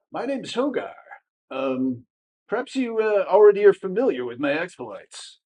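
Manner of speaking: a hint of a smarmy braggart; self-satisfied boasting stranger; smarmy Hogar. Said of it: "with kind of a smarmy, uh, swagger".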